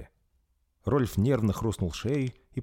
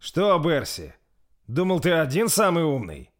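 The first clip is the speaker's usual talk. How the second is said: higher, louder mocking taunt; snide; 9 semitones higher, 6 dB louder than usual. A narrator's reading. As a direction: gloat at Bersi. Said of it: "ехидно улыбнулся Рольф". (Рольф ехидно насмехается над Берси)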